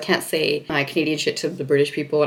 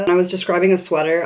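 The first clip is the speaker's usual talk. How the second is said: usual speaking voice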